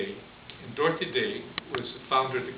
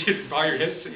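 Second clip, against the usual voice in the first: normal talking voice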